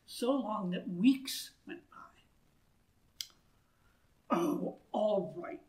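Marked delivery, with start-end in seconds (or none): 4.3-5.6 muttering. A narrator's reading. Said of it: "he muttered".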